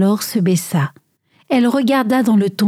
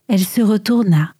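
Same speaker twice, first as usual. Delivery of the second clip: usual speaking voice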